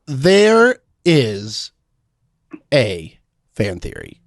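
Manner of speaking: slow, portentous announcement with long pauses; mock-grave build-up, word by word; dramatic reveal.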